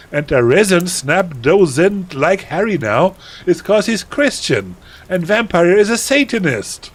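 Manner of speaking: high-pitched, sing-song whining complaint; put-on voice of a huffy fan-fiction writer; indignant teen author's note. Fan-fiction author telling off her flamers, indignant.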